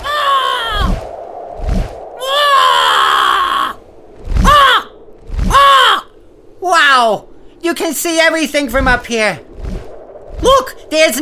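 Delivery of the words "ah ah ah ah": high excited shrieks while flying; thrilled screams. Acted. Flying bird man screaming with thrill. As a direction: yell with the rush of flight.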